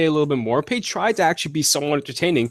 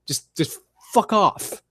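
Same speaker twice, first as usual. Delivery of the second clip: slow, drawn-out fuck off; fed-up dismissal; 6 semitones higher, 3 dB quieter, slower than usual. Real, not acted. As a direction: drag it out in disgust. Fed up with commentators.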